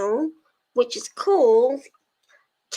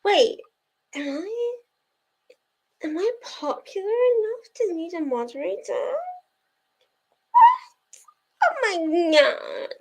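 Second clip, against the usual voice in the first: mock disbelief; 7 semitones higher than usual. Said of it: "and I'm like".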